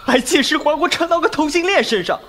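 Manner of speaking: rising indignant swear; exasperated outburst. Acted. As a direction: build to an outraged yelp. Outrage at new body.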